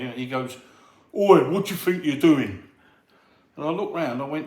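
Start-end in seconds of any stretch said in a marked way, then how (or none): 1.1-2.6 lightly imitated husky challenge. Gym hard man challenging a stranger at the speed ball, lightly husky. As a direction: put a touch of husk into the challenge. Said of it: "like, husky voice come up to me".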